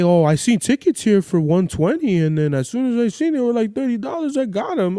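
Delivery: high-pitched mocking imitation; chatty know-it-all in a high voice; bragging weirdo. Mimics the bragging liar.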